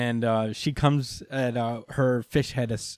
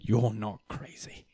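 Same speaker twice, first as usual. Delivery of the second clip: measured, slightly slowed dramatic voice; Captain Britain. Retold or imitated. Brother assuring Rogue he knows his sister, solemn delivery. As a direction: say it slowly and earnestly. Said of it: "Captain Britain is like".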